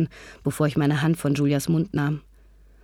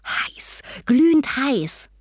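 excited game clue; 8 semitones higher, much slower than usual. Acted. Hot-and-cold game, getting excited.

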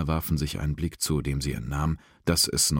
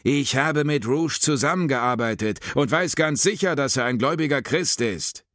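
high, indignant insistence; heated insistence; 11 semitones higher, 3 dB louder than usual. A narrator's reading. Sinclair defending an agent he worked with, heated insistence. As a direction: argue it hotly, pitch raised high. Angry interruption continues in argument.

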